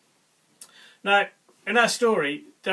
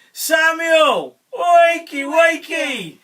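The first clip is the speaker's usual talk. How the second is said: high, loud storybook call; 8 semitones higher, 11 dB louder, much slower than usual. A narrator's reading. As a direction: call loudly to rouse a sleeper. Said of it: "God called again".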